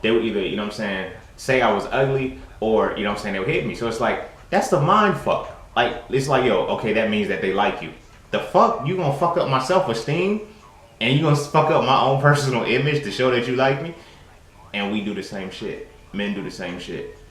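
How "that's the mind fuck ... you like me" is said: high, loud incredulous questions; indignant. Real, not acted. To the kids who insulted him as a crush, loud incredulous protest. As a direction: demand an answer from the bully.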